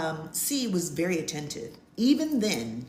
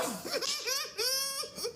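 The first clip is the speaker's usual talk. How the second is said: high squealing laugh; 6 dB quieter than usual. Real, not acted. Laughing at herself.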